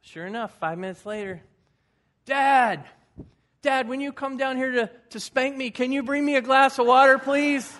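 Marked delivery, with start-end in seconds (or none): 2.2-7.7 put-on high, loud child voice begging; desperate little boy. Boy calling from bed, begging dad to bring water along with the spanking, high loud child voice. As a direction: beg for water at full volume like a small boy. Boy calls third time.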